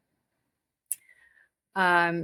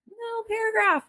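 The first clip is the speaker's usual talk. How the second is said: much higher pitch on "little"; tiny high voice. Real, not acted. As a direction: shoot "little" up high to show how small it is. Repeats it to stress smallness.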